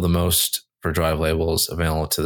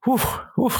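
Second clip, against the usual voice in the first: relieved whew. Real, not acted. Relief after a long list.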